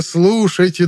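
high call falling at the end; town crier. A narrator's reading. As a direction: drop the repeated call down. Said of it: "глашатай, обязанный объявлять публике".

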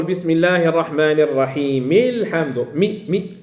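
slow recitation, "الرحيم الحمد" linked as ar-rahimil-hamdu; joined recitation. Shown as a demonstration. Teacher demonstrating wasl, "الرحيم الحمد" slowly joined as rahimil-hamdu. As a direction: run "الرحيم" into "الحمد" on mi, dropping the hamza. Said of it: "في الوصل".

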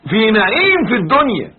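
loud, high, slow stress on نعيم and الدنيا; emphatic insistence. Shown as a demonstration. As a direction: stretch نعيم and hammer home الدنيا. Driving home the corrected reading.

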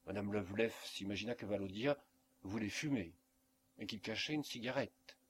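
ordinary delivery

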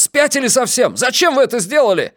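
high-pitched outraged yelling; frantic, high accusing outburst. A narrator's reading. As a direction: yell at the boss in disbelief. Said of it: "завопил Трэвис".

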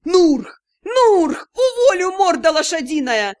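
loud high yells, then the threat; yelling. A narrator's reading. Fleeing partner yelling at his new worker to hold the aunts, loud. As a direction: bellow the name and threaten the worker. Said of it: "с воплем".

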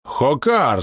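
high, startled exclamation; exclaimed cry. A narrator's reading. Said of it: "закричал полковник". (возглас, вскрик)

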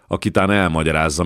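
plain reading voice

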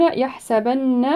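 يحسبن with the doubled n held in a long nasal hum, very slowly; يحسبن with full ghunna. Shown as a demonstration. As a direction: hold the نّ of يحسبن humming. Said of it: "النون مشددة غنة أكمل ما تكون".

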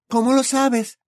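put-on curious young girl. A narrator's reading. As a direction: Hermione asking Charlie, high voice. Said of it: "preguntó Hermione".

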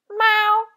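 high, loud little meow; kitten's meow. A narrator's reading. Hidden kitten interrupting the scolding with a high meow. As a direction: mew like a kitten. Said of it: "si udì".

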